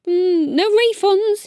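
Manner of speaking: mumbled. Acted. Chameleon retreating from the crowd, grumbling mumble. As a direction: grumble it while leaving. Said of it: "he mumbled".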